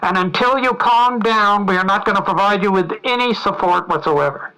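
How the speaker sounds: pompous loud official lecturing a drowning man; megaphone shout. Retold or imitated. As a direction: bellow it down coldly through a megaphone. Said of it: "through the megaphone he shouts".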